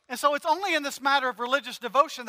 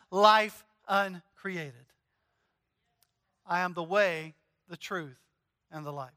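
fading to near silence at the end; hushed devotional reading; 4 semitones lower than usual. Acted. Reads Kempis quote.

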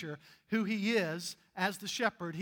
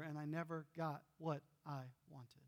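quiet ending; 4 semitones lower, 10 dB quieter than usual. Acted. End of a sad poem.

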